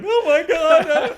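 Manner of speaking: high, loud, slow, crying and laughing wail; bawling. Retold or imitated. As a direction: break down sobbing. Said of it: "I'm bawling and crying".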